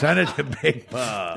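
slow Southern father voice; slow, playful Georgia drawl; Big Bob. Retold or imitated. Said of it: "I can fall back on that character".

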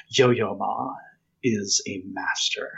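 low, slow, snooty pronouncement; snooty older lady. Retold or imitated. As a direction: mimic a haughty older woman correcting someone. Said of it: "looked at me very snootily".

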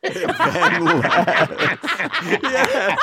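laughing echo, drawn out. Real, not acted. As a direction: laugh the words out. Echoing pun, laughing.

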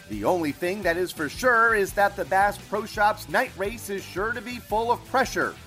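ordinary talk, nothing marked

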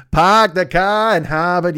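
a second, stronger try at the Boston phrase; Boston accent. Acted. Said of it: "Boston accent I can never pick up".

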